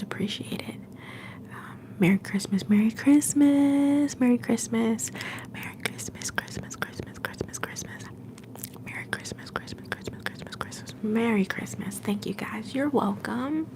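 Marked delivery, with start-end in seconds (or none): 2.7-5.1 drawn-out whisper with a lift in volume; slow whispered greeting. Shown as a demonstration. Greeting the live stream, slow stretched whisper. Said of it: "whispered greeting echo". 5.5-10.9 much softer whispered repeats that fade away; fading whisper echo. Shown as a demonstration. Greeting viewers, repeated words fading to a faint whisper. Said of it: "whispered greeting echo".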